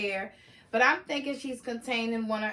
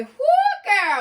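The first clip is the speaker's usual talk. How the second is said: high, loud laughing whoop; excited whoop; 12 semitones higher, 11 dB louder than usual. Real, not acted. Narrator cheers the aunt's entrance with a loud whoop. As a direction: whoop with delight.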